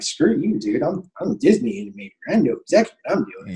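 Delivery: high, fast mock indignation; big attitude. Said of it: "gotten a big attitude about it".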